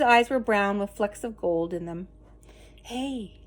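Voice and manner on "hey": much softer whispered greeting. A narrator's reading. Boy whispering to the scarecrow rabbit, very soft. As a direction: whisper it so nobody hears. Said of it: "he whispered to Edward".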